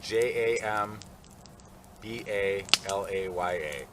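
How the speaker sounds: letters spelled slowly one by one; slow spelling out. Real, not acted. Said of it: "I had to get it straight in my head".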